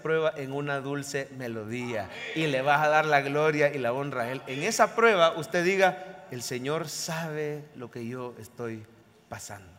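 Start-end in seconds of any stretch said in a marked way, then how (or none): 6.3-9.7 low, hushed, very slow; soft and low, dying away at the end; quiet surrender. Acted. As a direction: say it slowly as a humble private prayer. Models a prayer of surrender.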